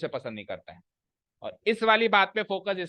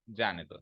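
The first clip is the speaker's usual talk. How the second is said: जाने दो set apart, much lower and softer; phrase after the pause; 7 semitones lower, 8 dB quieter than usual. Shown as a demonstration. Teacher finishing the pause demo, जाने दो low and separate. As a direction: drop जाने दो down after the break. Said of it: "अब मत पे pause है".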